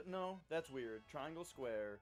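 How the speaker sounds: ordinary talk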